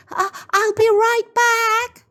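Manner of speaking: put-on high stammering girl's voice; May rushing off for the cane, nervous high stammer.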